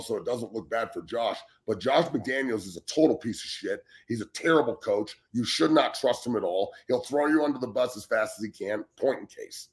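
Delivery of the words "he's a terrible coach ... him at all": ordinary delivery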